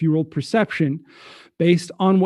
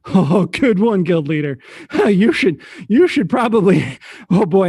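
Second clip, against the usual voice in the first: louder, broken up by laughter; laughing hard; 3 semitones higher, 4 dB louder than usual. Acted. Francois to guild leader, laughing too hard to finish. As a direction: laugh through it, barely finishing. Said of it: "he's laughing so hard".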